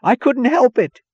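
very high, loud protest; crying out. A narrator's reading. Taggart excusing himself to Dagny, crying out. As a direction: cry it out in frantic self-defence. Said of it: "He cried".

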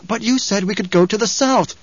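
high-pitched, interrupting objection; protesting. A narrator's reading. Chani objecting to Paul's order to stay, protesting. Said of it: "she protested".

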